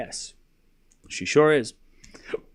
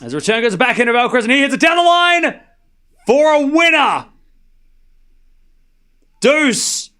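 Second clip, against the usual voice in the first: excited play-by-play; 10 semitones higher, 7 dB louder than usual. Real, not acted. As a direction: shout the winning shot home. Live tennis play-by-play.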